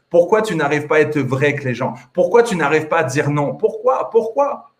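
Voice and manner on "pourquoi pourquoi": high-pitched, pressing "why"; insistent questioning. Real, not acted. Coach challenging a listener who can't say no, insistent high questioning. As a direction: push the question up high, urgently.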